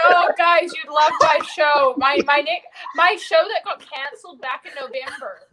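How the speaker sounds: loud, laughing announcement; loud plug. Real, not acted. Plugging his cancelled show, loud and laughing. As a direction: shout the plug to the room.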